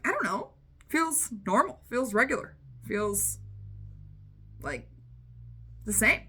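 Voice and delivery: waver as if weighing it up; sing-song dithering, halting at the end; unconvinced shrug. Retold or imitated. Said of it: "And we're like".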